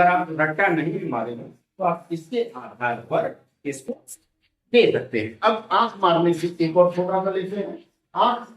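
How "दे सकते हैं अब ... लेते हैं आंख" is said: normal talking voice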